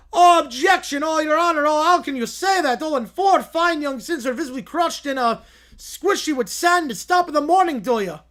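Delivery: high, loud pompous courtroom lawyer; hammy lawyer voice. Acted. To the judge, a blustering lawyer pleading his case. Acted lawyer in mock trial.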